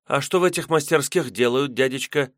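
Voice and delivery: put-on high, curious girl voice; young girl voice. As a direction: ask like a wide-eyed young girl. Narrator voices young maiden.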